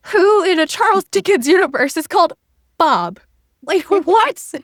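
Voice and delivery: loud, high-pitched disbelief; mock incredulous. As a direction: act out being baffled by the absurdity. Retelling her baffled reaction to name.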